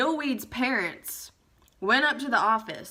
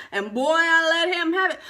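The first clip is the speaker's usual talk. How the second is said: emphatic boast; 7 semitones higher, 6 dB louder than usual. Real, not acted. Boasting of furious telling-off.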